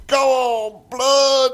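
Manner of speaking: high, loud shout to the stage; yelled loud. Retold or imitated. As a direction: call out loud into the silence. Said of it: "I yelled real loud".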